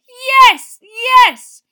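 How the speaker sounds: loud, high triumphant yells; cheering yell. A narrator's reading. Ron yanking Harry's arm up, loud cheering. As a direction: keep shouting in victory.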